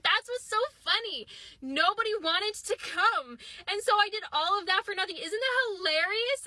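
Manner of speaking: high, over-cheery confession; mock hilarity. Best friend confessing her lie, mock cheery. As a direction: present the disaster as a hilarious joke.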